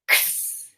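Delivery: X as a voiceless ks, not the letter name; isolated final sound. Shown as a demonstration. Teacher isolates the end of box, X as ks. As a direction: say X as k running into sss. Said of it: "What's the last sound".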